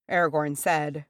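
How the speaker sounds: plain reading voice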